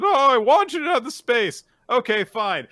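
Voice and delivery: squeaky high refusal; high flustered protest. Retold or imitated. Shy boy protesting to the girl, high and flustered. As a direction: yelp it out nervously. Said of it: "And he's like".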